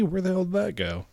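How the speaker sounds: ordinary talk, nothing marked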